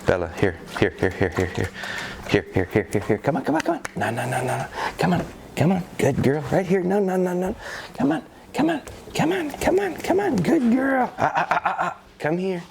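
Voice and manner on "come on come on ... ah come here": high-pitched coaxing dog voice; bright high puppy-calling voice; tone goes up. Real, not acted. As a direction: go way up high to call the pup in. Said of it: "So you heard that tone go up".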